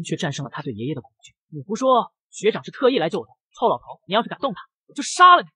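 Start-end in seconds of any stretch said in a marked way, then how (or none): 1.5-5.5 higher, building to a louder shouted threat; a grandson screaming at his grandfather to protect his senior; furious outburst. Acted. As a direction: blow up at the old man. Boy defends his senior against grandfather.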